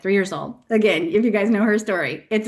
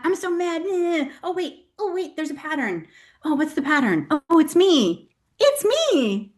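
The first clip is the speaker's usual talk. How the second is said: high, hammed-up shift from mad to "oh wait"; mock inner monologue; 7 semitones higher than usual. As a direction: play your own voice catching itself mid-tantrum. Mock self-talk, anger to realisation.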